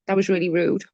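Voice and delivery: ordinary talk, nothing marked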